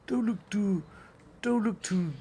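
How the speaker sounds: put-on high-pitched grand old thespian; John Gielgud. Quoting Olivier in the wrong impression, high theatrical voice. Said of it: "no, I'm doing Gil-, Gilgan now".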